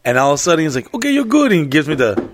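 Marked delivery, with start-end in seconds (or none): 0.9-1.5 put-on high-pitched Mexican-accented all-clear; imitated Mexican accent. Mexican operator taps the ride, chirped mock Mexican accent. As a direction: sign him off in a Mexican accent. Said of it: "the Mexican dude was like".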